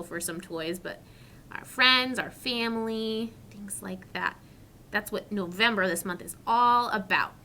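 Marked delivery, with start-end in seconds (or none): 3.5-4.4 soft whisper. Real, not acted. Soft aside to children.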